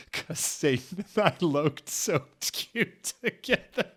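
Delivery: words breaking up with laughter; can't hold the giggles in; real laughter, pitched high; laughing. Real, not acted. Reader cracks up mid-line.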